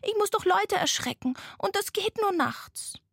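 high-pitched little ghost voice. A narrator's reading. Tired ghost objecting to the sloth, high voice. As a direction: protest in a small, high voice. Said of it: "sagte das Gespenst".

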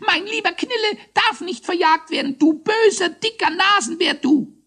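very high, loud squeaky hare; indignant squeal. A narrator's reading. Hare defends his friend against the judge, squealing indignantly. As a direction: squeal in outrage. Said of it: "Er quietschte empört".

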